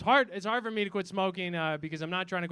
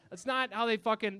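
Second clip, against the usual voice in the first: ordinary delivery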